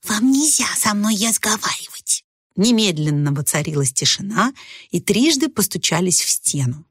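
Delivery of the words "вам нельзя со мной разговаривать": scolding little whisper; reproachful whisper. A narrator's reading. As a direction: hush them with a stern whisper. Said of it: "укоризненно прошептала".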